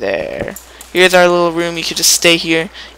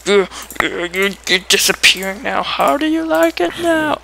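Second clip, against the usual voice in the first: taunting zombies; 5 semitones higher than usual. Real, not acted.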